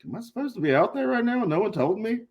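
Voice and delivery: high-pitched mock panic; retold bewilderment. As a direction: ask it high, imitating your own confusion. Retold panic at missed entrance.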